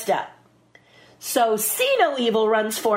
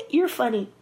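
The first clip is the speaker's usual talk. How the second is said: [name]; 5 semitones higher than usual. Retold or imitated. Actress imitates co-star amused by her, giggly impression. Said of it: "that's a perfect impression".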